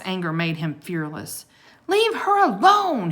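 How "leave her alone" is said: high, loud protective yell; angry shout. Acted. Jack yelling at the wolf sniffing Annie, angry. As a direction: defend her fiercely. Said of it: "he shouted".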